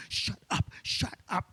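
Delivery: unvoiced hiss of shut up; mock church shush; hushed. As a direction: hush him sharply under breath. Wife hushing him in church.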